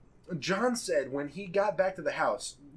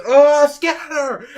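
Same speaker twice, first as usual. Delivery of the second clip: high, loud, drawn-out yell; shouted alarm; 11 semitones higher, 13 dB louder, slower than usual. Retold or imitated. Dan rips off the tape to warn friends, shouting. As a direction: yell it out slowly to send everyone running. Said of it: "and he goes".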